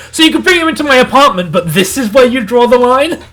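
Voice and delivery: high, loud incredulous retort; mock outrage. Host to Horse Knight outside the diner, mock outraged disbelief. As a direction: imitate someone exasperated by double standards.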